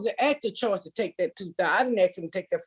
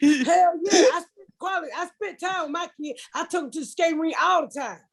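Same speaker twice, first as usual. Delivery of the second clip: mock ex defending himself; 9 semitones higher than usual. Ex boasting to her about rink trips, high and indignant. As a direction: play the ex puffing up over the skating rink. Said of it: "He said".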